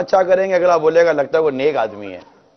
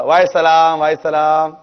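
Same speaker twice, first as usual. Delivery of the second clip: mock hasty reply; 4 dB louder than usual. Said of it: "سامنے والا بھی بہت جلدی".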